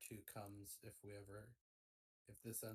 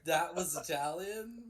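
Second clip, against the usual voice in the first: incredulous; 7 semitones higher, 15 dB louder, slower than usual. Real, not acted. Teasing disbelief at accent.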